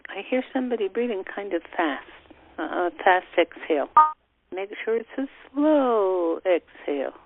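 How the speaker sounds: soothing breathing instructions; guided breathing meditation. Acted.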